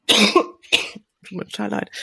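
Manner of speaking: rough, slow, throaty voice; frog in throat. Real, not acted. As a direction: speak through a tickly throat. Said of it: "einen Frosch im Hals".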